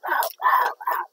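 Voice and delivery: loud, raspy little gremlin "ow, ow, ow"; imitating a gremlin scratching its throat, loud and rough; gremlin noises. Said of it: "I'm a gremlin just going".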